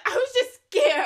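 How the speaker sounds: high, loud quote bursting into laughter; laughing retold quote. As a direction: imitate her slowly through laughter.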